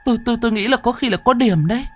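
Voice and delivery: very high, stammering, frightened voice; scared wife gasping out her story, pitch way up; panting, terrified wife. Acted. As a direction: stumble over the words in high-pitched panic. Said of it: "mặt cắt không còn giọt máu hổn hển".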